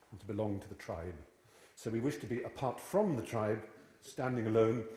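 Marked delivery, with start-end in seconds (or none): none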